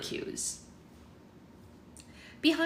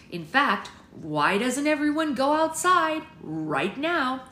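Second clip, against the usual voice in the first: loud, higher, slow announcement; forced urging; 6 semitones higher, 7 dB louder, slower than usual. A narrator's reading. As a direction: project it slowly to the whole room. Poppy urging everyone out.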